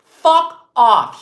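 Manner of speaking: a loud furious brush-off; angry outburst. Real, not acted. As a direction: spit it out loud and hard, real anger. Angry rant at critics.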